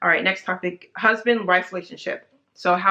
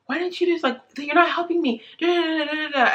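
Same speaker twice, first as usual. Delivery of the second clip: high-pitched snippy nagging, retold; 9 semitones higher than usual. New mom nagging her partner for not helping, mock snippy. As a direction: imitate yourself picking at a partner. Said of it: "so snippy with Chris".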